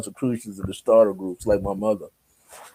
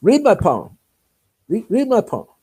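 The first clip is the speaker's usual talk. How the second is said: high-pitched pushy demand; acted-out impatient command; imitated insistent demand; 9 semitones higher, 3 dB louder than usual. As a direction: press them to obey, pitched up. Woman demands a palm reading.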